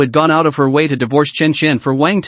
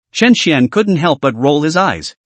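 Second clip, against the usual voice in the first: plain reading voice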